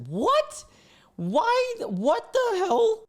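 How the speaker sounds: high-pitched incredulous outcry; real shock, pitch shooting way up; shocked outburst. Real, not acted. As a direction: blurt it out, high and appalled. Shocked reaction to a creepy bio.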